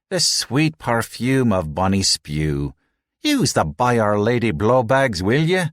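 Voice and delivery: slow Irish brogue rising into a high will ye; mocking the spewing newcomers in a thick Irish brogue; broad Irish brogue. A narrator's reading. An Irish crewman teases the sick newcomers, broad Irish brogue. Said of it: "in a broad brogue".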